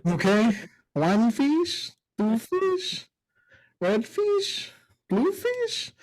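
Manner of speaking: put-on high, questioning Spanish-accented read; mock Spanish accent. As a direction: imitate a Spanish-speaking mom reading English, each phrase rising. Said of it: "with a fucking accent".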